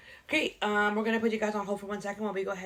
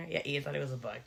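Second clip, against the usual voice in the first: ordinary delivery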